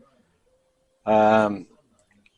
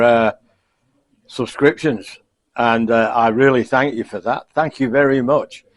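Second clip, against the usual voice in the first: ordinary talk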